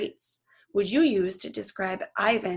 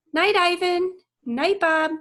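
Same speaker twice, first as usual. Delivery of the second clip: higher, louder called farewell; calling goodbye; 10 semitones higher, 6 dB louder than usual. Acted. Julia calls goodbye to Ivan and Bob, bright call. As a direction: call out a cheerful goodnight.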